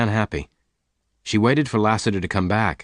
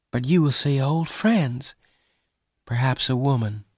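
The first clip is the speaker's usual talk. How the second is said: sadly; 5 semitones higher than usual. A narrator's reading. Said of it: "Bess said sadly".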